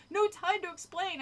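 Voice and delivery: plain reading voice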